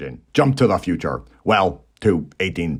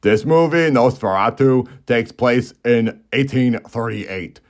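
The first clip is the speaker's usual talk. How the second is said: normal talking voice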